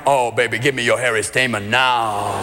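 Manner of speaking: deep, low crooning seduction. Retold or imitated. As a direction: croon it low like a soul singer.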